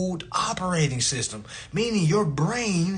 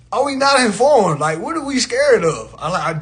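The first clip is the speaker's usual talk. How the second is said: ordinary talk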